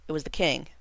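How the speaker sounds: ordinary delivery, nothing marked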